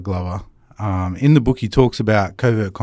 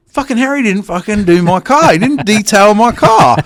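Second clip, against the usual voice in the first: higher, louder hammed-up fuming; mock angry rant; 11 semitones higher, 6 dB louder than usual. Man acting out his secret grudge against Harry, higher, louder mock rant. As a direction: blow up at Harry in a mock tantrum. Retold hidden grudge about neighbour.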